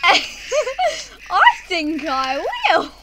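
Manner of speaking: slow, laughing through words. Real, not acted. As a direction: laugh it out teasingly. Laughing mischief.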